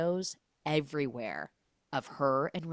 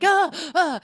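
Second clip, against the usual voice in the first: high loud mock yelp; mock gasp; 13 semitones higher, 9 dB louder than usual. Mother seeing Ryan on the couch, mock yelp. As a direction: yelp at the sight.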